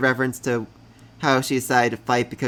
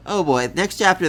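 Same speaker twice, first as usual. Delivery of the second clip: usual speaking voice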